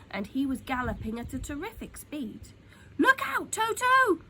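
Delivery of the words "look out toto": high, loud warning yell; Humpy Rumpy yells a warning to Toto; urgent shout. A narrator's reading. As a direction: shout to get the boy out of danger. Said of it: "shouted Humpy Rumpy".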